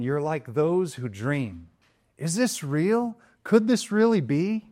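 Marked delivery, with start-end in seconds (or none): 2.2-4.7 amazed wonder. Retold or imitated. Preacher voicing believers' amazement, wondering. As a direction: marvel as if dreaming.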